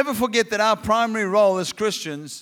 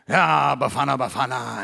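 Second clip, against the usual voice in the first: low grumbling moaner, trailing down; mock complainer; 8 semitones lower than usual. Imitating negative complainers.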